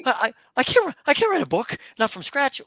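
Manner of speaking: high, loud, hurried objection; mock protest. Host voicing a doubtful would-be writer, mock protest.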